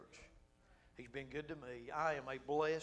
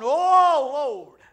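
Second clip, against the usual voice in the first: much higher, much louder hollering to God; altar hollering; 9 semitones higher, 21 dB louder than usual. Retold or imitated. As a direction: cry out like old-time altar call. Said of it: "they'd be hollering".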